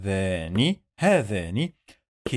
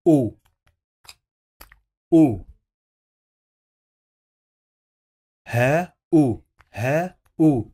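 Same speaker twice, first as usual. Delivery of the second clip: loud vowel prompt; 4 semitones higher, 5 dB louder, much faster than usual. Shown as a demonstration. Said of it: "loud drawn-out vowel prompts".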